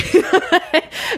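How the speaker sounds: airy giggle, up high; a quick breathy laugh. Real, not acted. Laughs after "bring it out.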